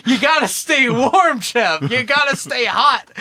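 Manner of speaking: hammed-up doctor pleading; mock urgent shout. Doctor urging Chev to keep warm, mock shouting.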